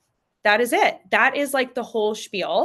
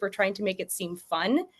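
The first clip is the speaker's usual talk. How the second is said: usual speaking voice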